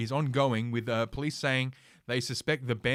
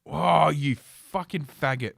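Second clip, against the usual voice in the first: angry outburst; 3 semitones higher, 7 dB louder, slower than usual. Real, not acted. Mouse misclick outburst.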